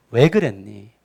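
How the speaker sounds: low, considerate question; gentle God. Acted. God coming to talk to Adam, gently. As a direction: ask gently, leaving room to answer. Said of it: "아담을 향한 배려와".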